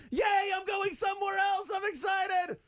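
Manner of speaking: very high, shouted glee; a gleeful coach skipping away; squeaky shouted delight; Matt Nagy impression. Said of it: "[name]'s Matt Nagy impression".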